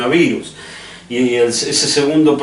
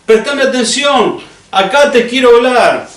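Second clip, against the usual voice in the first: loud, high-pitched call for attention; urgent, raised-voice call from God; God shouting; 11 semitones higher, 4 dB louder than usual. Acted. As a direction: shout to grab someone's attention. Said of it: "te está gritando".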